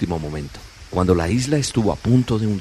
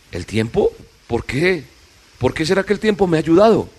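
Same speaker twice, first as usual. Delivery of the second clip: rising, louder surprised questions; puzzled; 8 semitones higher, 4 dB louder than usual. Acted. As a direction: ask it more and more amazed. Puzzled question about Time.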